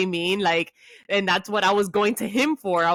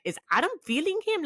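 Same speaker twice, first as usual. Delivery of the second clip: much higher, fast, astonished; amazed disbelief; 11 semitones higher, faster than usual. Real, not acted. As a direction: blurt it out in sudden amazement. Amazed realization mid-story.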